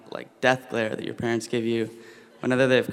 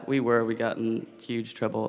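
ordinary delivery

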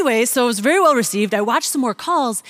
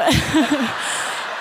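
laughing; 4 semitones higher than usual. Real, not acted. Laughing at own story.

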